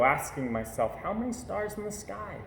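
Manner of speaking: usual speaking voice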